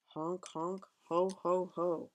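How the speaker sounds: loud honking ho ho ho; honking goose laugh. A narrator's reading. A noisy gander laughing down at Hedgie, loud honking laugh. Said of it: "the gander laughed".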